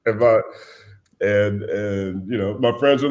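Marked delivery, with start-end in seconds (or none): none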